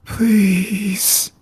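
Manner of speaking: faint, breathy, high plea; breathy weak voice. Acted. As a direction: beg weakly on a breath. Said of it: "in a very breathy, weak voice".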